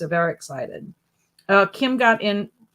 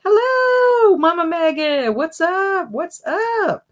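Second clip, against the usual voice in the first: excited high greeting; 10 semitones higher, 4 dB louder, slower than usual. Real, not acted. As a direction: sing out hello, high and loud. Greeting a viewer in live chat.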